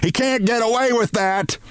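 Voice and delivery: high-pitched outraged scream, controlled volume; screamed accusation. A narrator's reading. Missus Barrows bursts in on Martin, screaming. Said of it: "she screamed".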